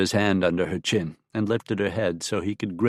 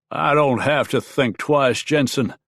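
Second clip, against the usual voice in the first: higher, louder snapped retort; 5 semitones higher, 4 dB louder than usual. Acted. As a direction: snap it back at him, cutting. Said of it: "snapped Talbot".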